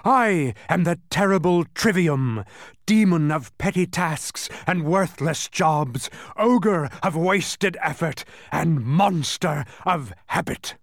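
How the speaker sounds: high, loud, slow villain's boast; triumphant proclamation. A narrator's reading. The terrible Trivium introducing himself as a demon, triumphant proclamation. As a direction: announce your terrible titles in triumph. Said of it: "he shrieked triumphantly".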